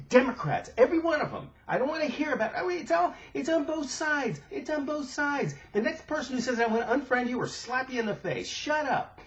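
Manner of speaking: real furious outburst. Real, not acted. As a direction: let the anger boil over, pitch climbing. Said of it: "I'm just furious".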